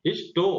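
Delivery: हिस्टो with a barely sounded h; soft h. Shown as a demonstration. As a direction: glide over the h in हिस्टो. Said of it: "तो ये है पर ज्यादा जोर नहीं हो रहा है". (हिस्टो में ह हल्का)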